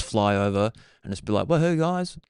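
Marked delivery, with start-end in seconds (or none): 1.5-2.2 high, light "hey guys" voice; cheery mock greeting. Clown doctor greets patients through a drone, high-pitched.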